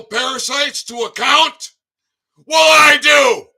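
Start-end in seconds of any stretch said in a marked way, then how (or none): 2.5-3.5 slow, loud defiant shout; furious rant shout. Real, not acted. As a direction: hurl it back at them. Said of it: "How was that for a rant".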